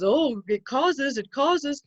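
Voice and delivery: slow, mock-certain overclaim; mocking wrong claim. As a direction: parody a sloppy headline. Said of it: "where it says".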